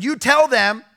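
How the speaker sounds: high and loud instruction; retold shouted command. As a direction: shout the order up the steps. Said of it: "I said".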